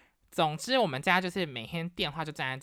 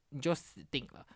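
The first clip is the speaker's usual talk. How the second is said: ordinary delivery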